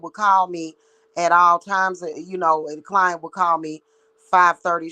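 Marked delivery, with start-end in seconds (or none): none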